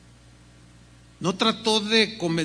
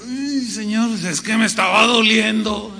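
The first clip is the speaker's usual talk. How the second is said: louder, whining excuse to the Lord; mock-pained protest, raised voice; pleading whine; 2 semitones higher, 6 dB louder than usual. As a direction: plead half-jokingly that it hurt. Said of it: "Y yo".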